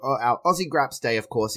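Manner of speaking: ordinary delivery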